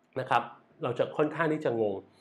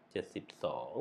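เจ็ดสิบสอง said much softer and lower, under the breath; quiet aside; 13 semitones lower, 15 dB quieter, faster than usual. Shown as a demonstration. Drum teacher checking the tempo to himself, much softer. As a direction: mutter เจ็ดสิบสอง to yourself while setting the metronome.

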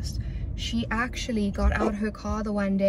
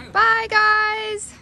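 high, loud farewell call; calling goodbye; 12 semitones higher, 8 dB louder than usual. Real, not acted. Leaving for a trip, high called-out goodbye.

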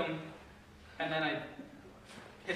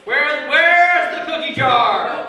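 slow, booming interrogation shout; louder bad cop; 3 semitones higher, 9 dB louder, much slower than usual. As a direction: stretch it out and bellow at the suspect. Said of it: "Get louder".

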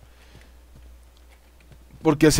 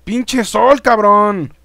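raised, exasperated cursing; exasperated outburst; 5 semitones higher, 4 dB louder, much slower than usual. Real, not acted. Player cursing the sun that blinds him, exasperated. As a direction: curse out loud in annoyance.

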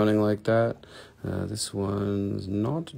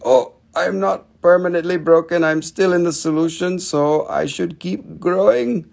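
a touch higher and louder, cheerful resolve; lightly voiced plucky crystal; 8 semitones higher, 6 dB louder than usual. Host voicing a broken crystal, lightly higher and determined. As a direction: give the crystal a light, brave little voice.

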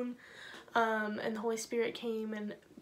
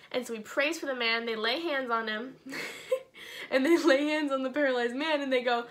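ordinary delivery

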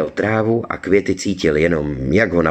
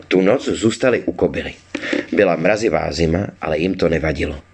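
usual speaking voice

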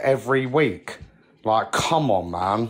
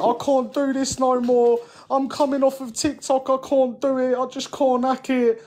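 high-pitched mock tearful whine; mock crying; 10 semitones higher than usual. Streamer mocking a rival's on-live breakdown, high mock crying. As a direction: imitate someone tearfully giving up, pitched well up. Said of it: "crying on live, going".

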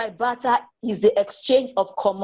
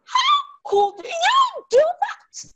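very high, slow, outraged cry; shocked mom; 3 dB louder, much slower than usual. A narrator's reading. Mom confronting her son, high shocked outcry. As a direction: stretch it out in disbelief. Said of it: "asked Missus Martha".

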